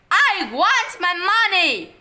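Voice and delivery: high, loud, slow demanding yell. A narrator's reading. Boy yelling for his money at Mom. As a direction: insist at full volume.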